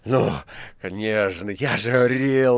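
agitated, drawn-out exclamation; excited shouting. A narrator's reading. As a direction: burst out with vindication. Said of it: "возбуждённо кричал администратор". (возбуждённый выкрик)

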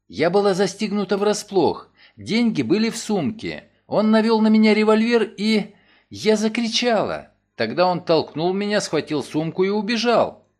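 Nancy recounting the holdup to Mason; frightened retelling. Acted. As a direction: let the fear rise at the revolver. Nancy's figure voice.

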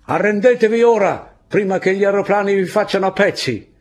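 high, loud yelled ultimatum; threatening shout from behind the rocks; shouted threat. Acted. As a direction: call out the surrender demand across the rocks. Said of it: "La voce usciva da dietro le rocce".

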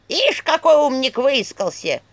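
feisty, high, loud staff voice; slow, heated jeer in character; Черепуша. A narrator's reading. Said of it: "Голос её был очень азартным". (азартный голос посоха, громко и высоко)

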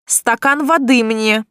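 slow, demanding order; bossy demand for water; commanding. Acted. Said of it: "но меньше почтительности".

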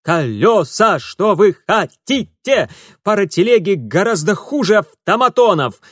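yelled over the noise; yelling. Acted. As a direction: shout it back, high and loud. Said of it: "проорал в ответ Митя". (кричит в ответ)

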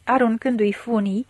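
usual speaking voice

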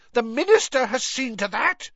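high-pitched furious outburst; McGonagall's shriek. A narrator's reading. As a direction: shriek the bitter accusation, trembling with fury. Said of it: "she shrieked".

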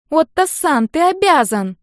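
high, loud whiny little sister; insistent high-pitched whine; whining. A narrator's reading. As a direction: whine demandingly at your dad. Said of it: "ныла по своему обыкновению девочка".

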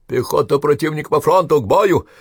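high, loud military command; battle orders. Acted. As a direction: call the men to arms.

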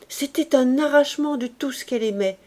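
usual speaking voice

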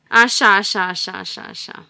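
loud gleeful witch cry fading to a mutter; an evil crone rejoicing, then trailing off; gloating witch. Acted. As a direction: savour her victory with spite. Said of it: "râde bucuroasă vrăjitoarea".

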